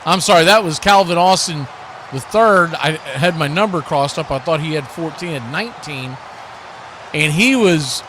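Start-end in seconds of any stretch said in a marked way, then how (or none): none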